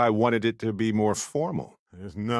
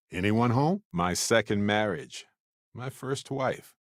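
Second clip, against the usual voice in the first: ordinary delivery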